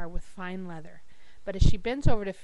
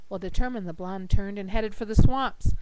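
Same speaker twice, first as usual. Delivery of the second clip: ordinary talk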